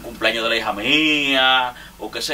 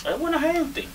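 much higher, half-joking squeak; high playful squeal; mock squeaky voice; 4 dB quieter than usual. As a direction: squeak it out teasingly. Squeaky emphasis on friend's niceness.

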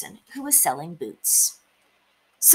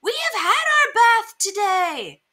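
high, loud shout of protest; crying out; 13 semitones higher, 4 dB louder than usual. A narrator's reading. Neighbours yell at the mouse flooding their house, crying out. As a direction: call out high and indignant to the neighbour. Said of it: "The people in the house next door cried".

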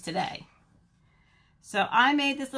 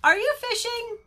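high, louder rabbit voice; inquisitive rabbit; 13 semitones higher, 6 dB louder than usual. Acted. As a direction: ask high and eager, as the rabbit. Rabbit character asks penguin.

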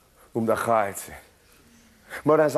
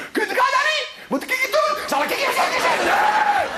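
giggly high voice. Acted.

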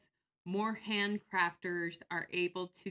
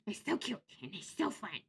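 higher, mock-cutesy singsong; mocking catchphrase; 4 semitones higher than usual. Vlogger repeating the phrase she mocked, mock-cutesy. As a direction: make fun of the gushy phrase. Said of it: "like, making fun of it".

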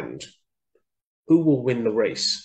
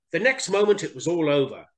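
usual speaking voice

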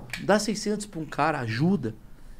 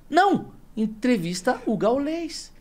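high exclaimed no, then slow high drawn-out words; exaggerated, high-pitched insistence; emphatic correction; 8 semitones higher, much slower than usual. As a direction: jump up high and stretch out the answer. Answers his own hypothetical choice emphatically.

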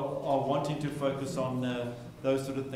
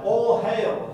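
much louder, higher "All hail"; mock proclamation; 7 semitones higher, 10 dB louder than usual. Shown as a demonstration. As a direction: shout it like a royal herald. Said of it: "If I said that on Sunday morning".

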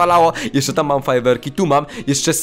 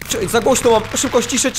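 usual speaking voice

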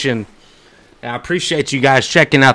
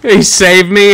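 normal talking voice

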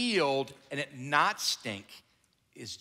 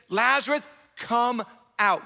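high, loud summons; loud call; 7 semitones higher, 5 dB louder than usual. Acted. Jesus calls loudly into the tomb for Lazarus. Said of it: "Jesus called out in a loud voice".

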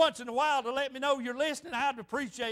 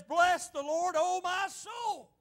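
higher, slow preacher's declaration; proclaimed psalm; 5 semitones higher, slower than usual. Retold or imitated. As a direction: stretch out "oh my soul" in praise.

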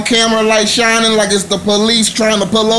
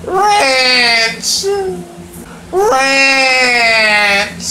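mock wail; 3 semitones higher than usual. Mournful calls for lost ranch.

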